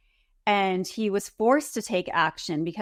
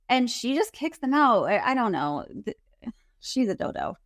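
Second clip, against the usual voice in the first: ordinary talk, nothing marked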